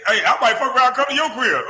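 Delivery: high, fast, sleazy come-on voice, mocking; mocking Gil. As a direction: put on a high, fast smooth-talker voice to mock him. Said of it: "this is how you used to talk to girls".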